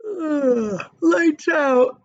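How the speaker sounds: sleepy groan, then lights out; drowsy lights out. Acted. Sleepy centipede calling lights out from his hammock, groaning. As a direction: grumble it half asleep. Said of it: "the centipede said drowsily".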